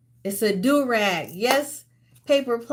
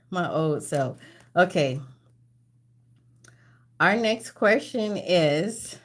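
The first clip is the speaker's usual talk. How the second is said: ordinary talk, nothing marked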